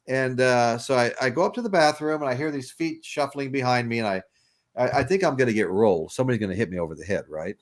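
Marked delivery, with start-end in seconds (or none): none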